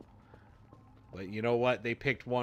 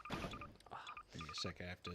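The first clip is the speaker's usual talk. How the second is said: whispering; 3 semitones lower, 12 dB quieter than usual. Real, not acted. Quiet aside while busy in game.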